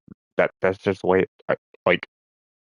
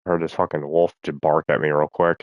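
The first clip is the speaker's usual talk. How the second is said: normal talking voice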